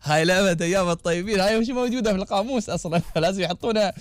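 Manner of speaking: real laughter breaking through the words; laughing. Real, not acted. Host laughing at a listener's old neighbourhood game. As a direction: laugh it off, giggling between phrases.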